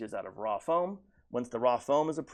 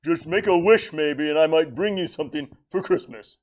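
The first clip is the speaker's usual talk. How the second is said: lightly voiced jolly Santa; a hint of Santa, louder; Santa Claus voice; 4 semitones higher, 8 dB louder than usual. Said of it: "the Santa Claus character".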